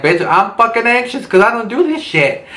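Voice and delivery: high, exasperated outburst; exasperated snap. Retold or imitated. Answering her date who says don't be anxious, high exasperated snap. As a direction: fire it back, fed up and rattled.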